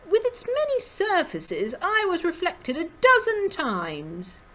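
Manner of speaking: very high, swooping dandy voice; self-admiring show-off, loud and lofty; elegant, vain rabbit. A narrator's reading. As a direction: preen aloud in a high character voice. Vain Clooney admires his reflections.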